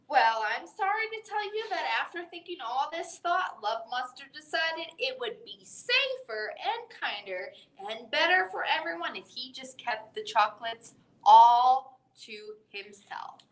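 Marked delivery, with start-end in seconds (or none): none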